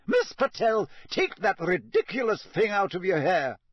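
high, snappish teacher's reprimand; strict schoolmistress telling off a pupil; Professor McGonagall. A narrator's reading. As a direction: snap a stern order, pitched high. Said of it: "Professor McGonagall snapped".

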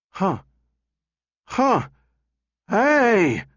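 high, startled exclamations; startled yelps building to a high "Hey"; vocal gyrations. A narrator's reading. Donovan breaks off at what he sees, high startled yelps. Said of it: "Donovan's vocal gyrations".

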